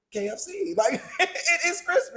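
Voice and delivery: laughing through the words; cracking up at her own joke. Real, not acted.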